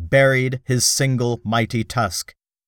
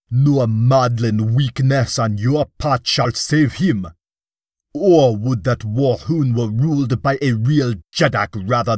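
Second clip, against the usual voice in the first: roaring defiance. A narrator's reading. Dak Kova taunting Bar Komas before the troops, roaring defiance. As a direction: spit the insult at the weakling king. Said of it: "roared Dak Kova".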